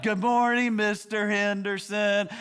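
high, drawn-out schoolkid chant; slow, high singsong greeting; little kids' voices. Retold or imitated. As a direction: mimic a whole class greeting the teacher in unison. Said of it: "It's like".

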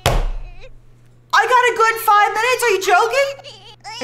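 high, loud and slow in disbelief; loud drawn-out disbelief; exasperated outcry. Real, not acted. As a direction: cry out in comic despair. Baby wakes again.

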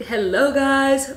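greeting through a yawn. Real, not acted. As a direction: say it mid-yawn, slowly. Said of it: "of course I'm gonna yawn now".

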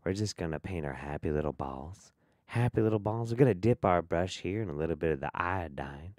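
soft, hushed Bob Ross painting voice; Bob Ross impression. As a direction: soothe like a TV painting teacher. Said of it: "doing his best Bob Ross".

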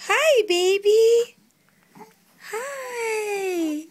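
high, loud and slow sing-song hello. Real, not acted. Mother greeting her baby, high and stretched out.

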